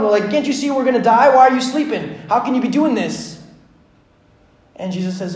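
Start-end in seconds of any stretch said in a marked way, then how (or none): 0.3-3.4 high, loud acted alarm; frantic, accusing questions in a raised voice; freaking out. Panicked disciples confronting sleeping Jesus, high and loud. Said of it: "the disciples are freaking out".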